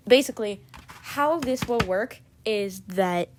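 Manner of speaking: ordinary talk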